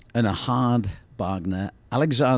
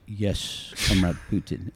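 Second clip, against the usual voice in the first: softer, lower, a little laugh slips in; calmed down; 3 semitones lower, 6 dB quieter, slower than usual. Retold or imitated. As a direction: back to quiet compliance. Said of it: "he calmed down".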